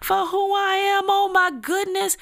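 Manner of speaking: offended inner rant. Retold or imitated. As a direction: replay your own hurt, worked-up reaction. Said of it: "I felt some kind of way".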